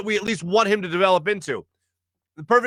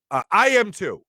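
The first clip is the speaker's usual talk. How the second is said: ordinary delivery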